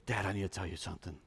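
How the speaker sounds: a hint of a squeaky little girl, fast; lightly voiced small daughter. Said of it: "I hear her little squeaky voice".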